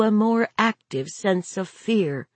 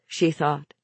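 ordinary delivery

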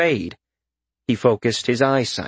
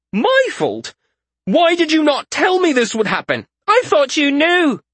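high, loud indignant shout back; indignant retort; 4 dB louder than usual. A narrator's reading. Malseus shouts back at Isaria in the argument, high and loud. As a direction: throw the blame back at her.

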